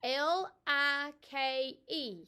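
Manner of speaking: slow and loud, each letter set apart; slow spelling. Shown as a demonstration. Teacher to kindergarten class, spelling like slowly and clearly. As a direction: spell it out letter by letter for little ones. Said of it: "now we're going to spell it".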